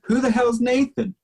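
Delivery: high, incredulous retold question; a hint of fed-up puzzlement; mock exasperation. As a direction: pitch it up in mock disbelief.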